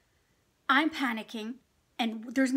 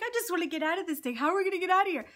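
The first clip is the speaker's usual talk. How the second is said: high, fast frantic pleading; retold fearful whine; panicked plea; 6 semitones higher, much faster than usual. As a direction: beg to get out in panic. Panicked pleading in stuck canoe.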